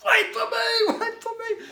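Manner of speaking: high, loud desperate yell after the car; panicked calling out. Retold or imitated. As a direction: scream after the car pulling away. Stewie running after departing car.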